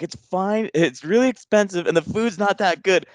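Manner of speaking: high-pitched, mock-mad outburst; retold exasperated scream. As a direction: imitate yourself losing patience with a deaf dad. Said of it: "I finally scream it 'cause I'm not realizing, and I'm just mad".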